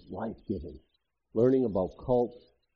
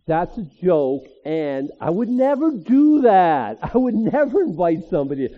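louder, higher, laughing aside; laughing joke; 6 semitones higher, 9 dB louder than usual. Real, not acted. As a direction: crack up at your own joke. Joking aside to congregation.

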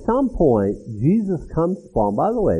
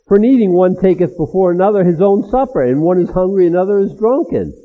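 louder, higher, full-force reading; indignant scripture reading; 4 semitones higher, 7 dB louder than usual. Acted. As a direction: read it out as an accusation.

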